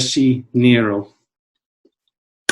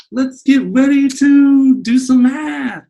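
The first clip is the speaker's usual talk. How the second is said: ring announcer; 12 semitones higher, 6 dB louder, slower than usual. Pretend. Boxing ring announcer parody.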